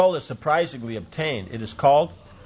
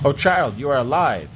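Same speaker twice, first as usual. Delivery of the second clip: a hint of a choked cry, louder; 3 semitones higher, 4 dB louder than usual. A narrator's reading. Jashoda finding her child alive, a touch choked. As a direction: call out with mild tearful relief. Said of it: "in choked voice".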